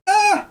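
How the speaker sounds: sharp high yelp of pain; loud, sudden "ah" as if struck; cry of pain. Acted. Player voices a character taking damage, pained yelp. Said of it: "Sounded painful".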